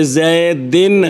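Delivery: زیدن ending in a short "i", drawn out, zaidin; زیدن with a slow "-in" ending; kasra ending. Shown as a demonstration. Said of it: "یہ جو فرق پڑ رہا ہے".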